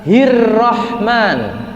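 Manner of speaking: "Hirrahman" very slow, "rr" held; doubled r. Shown as a demonstration. Teacher modelling tasydid on the r, slowly. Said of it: "Di atasnya ro".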